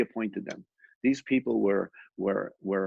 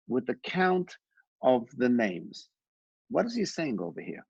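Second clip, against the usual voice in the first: usual speaking voice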